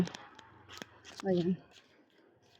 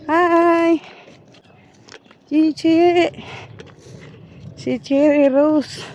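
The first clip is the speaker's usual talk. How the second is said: excited greeting; 8 semitones higher, much slower than usual. Real, not acted. Greeting child on the walk.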